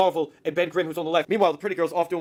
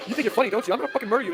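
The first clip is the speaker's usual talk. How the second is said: higher-pitched mock outrage; acted pissed off; 6 semitones higher, faster than usual. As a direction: imitate an indignant threat. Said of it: "she gets all pissed".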